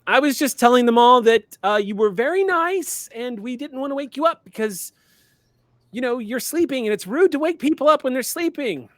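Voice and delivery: high, loud, hurried denials; panicked pleading. Acted. Ameep panics before the mountain spirit, high pleading. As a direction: backpedal fast, scared of the giant.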